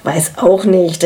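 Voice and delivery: plain reading voice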